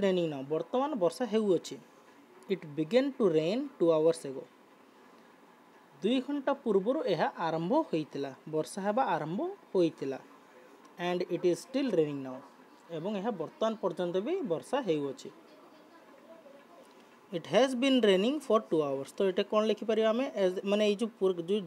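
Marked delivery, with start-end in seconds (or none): 2.5-4.4 slow model reading. Shown as a demonstration. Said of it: "teacher rereads example". 11.0-12.4 slow model reading. Shown as a demonstration. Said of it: "teacher rereads example". 17.3-19.1 slow model reading. Shown as a demonstration. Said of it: "teacher reads model answer".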